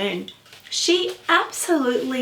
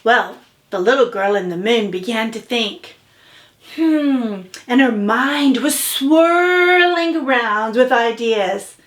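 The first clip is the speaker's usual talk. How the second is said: ordinary delivery, nothing marked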